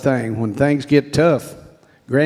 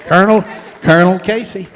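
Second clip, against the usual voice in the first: "Colonel" raised and louder; insistent repeat; 5 semitones higher, 5 dB louder, faster than usual. Real, not acted. Proud speaker repeats the new title to the graduate, insistent. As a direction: call out "Colonel" higher, with force.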